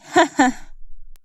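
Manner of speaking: villain's short taunting chuckle; mocking laugh. A narrator's reading. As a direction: laugh tauntingly to end the threat. Blackmailer laughs after his sarcastic goodbye. (ضحكة شماتة ساخرة)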